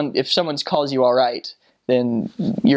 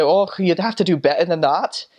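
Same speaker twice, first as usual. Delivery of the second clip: higher, put-on Scottish brogue; mock-scolding in a Scottish accent; 5 semitones higher than usual. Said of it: "that'd be the Scottish people".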